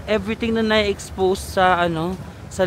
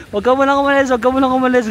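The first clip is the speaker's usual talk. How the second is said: put-on higher, louder whiny drunken plea; mock drunk pleading; 6 semitones higher, 5 dB louder, faster than usual. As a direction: beg her to stay like a tipsy pal. Drunks pleading her to stay.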